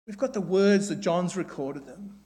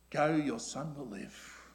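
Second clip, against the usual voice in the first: much softer, tender words of Jesus; gentle; 7 semitones lower, 12 dB quieter than usual. Acted. Preacher voicing Jesus' gentle promise to the father. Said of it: "He's gentle".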